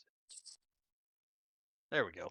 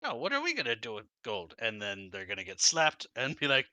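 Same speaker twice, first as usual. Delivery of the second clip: ordinary talk, nothing marked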